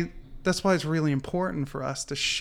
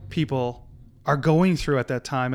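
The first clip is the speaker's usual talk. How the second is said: normal talking voice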